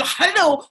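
"Hello" with a harsh KH at the start; high, throaty mock Spanish "Hello"; mocked guttural H. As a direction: rasp the H of "Hello". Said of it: "Even with a French accent".

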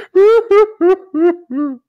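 high, loud imitated boo-hoo wail; hammed-up burst of sobs; mock sobbing. Reenacting tears at the music video, exaggerated high sobbing. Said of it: "I was crying".

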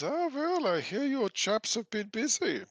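high, chirpy innkeeper; lightly hammed-up friendly innkeeper. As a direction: greet them as a chatty innkeeper. Innkeeper Lankus voiced by GM.

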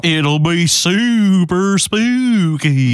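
slow, eerie ghostly drawl; spooky voice. Pretend. Cohost hyping the Halloween special in a slow ghostly voice. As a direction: moan it like a cartoon ghost.

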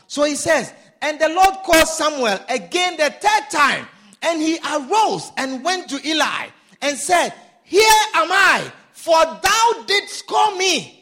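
high, ringing scripture reading; declaimed scripture. Acted. Preacher reading Samuel's calling to the church, declaimed scripture. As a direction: proclaim the verse to a congregation.

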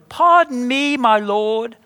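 mock British-accented plea, drawn out. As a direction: put on a posh British accent to address the Lord. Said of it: "I don't think Moses had a British accent".

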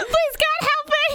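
high, loud hammed-up cry for help; mock panicked plea. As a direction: yell for help in pretend terror. Acts victim fleeing Bigfoot in skit.

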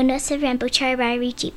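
ordinary delivery

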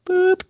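high, loud boop like a scanner beep; imitated beep. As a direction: squeak the machine beep. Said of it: "Put your four fingers here".

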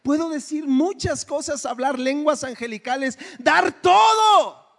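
Paul quoted with mounting force; rising preached quote. Acted. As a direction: climb until you cry out dar todo. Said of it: "Pablo decía".

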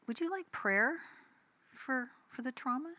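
high, soft retold offer; careful question, softened and lifted; tentative offer. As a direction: ask gingerly in a small high voice. Awkwardly offers neighbor prayer after attitude.

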